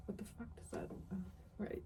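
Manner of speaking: breathless whisper of disbelief; under the breath, baffled; whispered what the fuck; whispered aside. Real, not acted.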